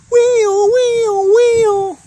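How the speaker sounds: very high, loud vocal siren; siren imitation. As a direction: wail like a police car.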